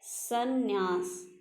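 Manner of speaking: संन्यास drawn out very slowly; teacher modelling संन्यास; slow careful pronunciation. Shown as a demonstration. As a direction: stretch संन्यास syllable by syllable. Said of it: "संन्यास ऐसे लिखा जाता".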